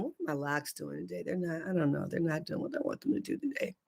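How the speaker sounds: muttered aside. Real, not acted. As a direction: grumble about your hair under your breath. Muttering about her hair.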